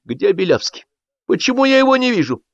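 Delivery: high, querulous questioning; high-pitched official indignation; stern demand. A narrator's reading. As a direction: demand to know where he is. Stern chairman demands missing man.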